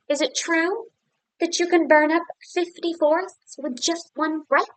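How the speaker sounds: butter up the dragon; flattering princess. Acted.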